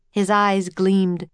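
plain reading voice

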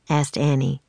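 usual speaking voice

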